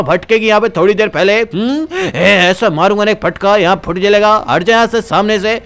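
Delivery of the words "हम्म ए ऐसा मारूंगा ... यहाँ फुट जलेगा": usual speaking voice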